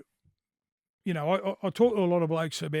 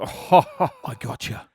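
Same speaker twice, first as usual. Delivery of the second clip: slow, drawn-out smug "gotcha"; son's slowed-down cheeky "I gotcha"; teasing gotcha; 4 semitones lower, 5 dB louder, much slower than usual. Retold or imitated. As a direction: draw it out like a prank payoff.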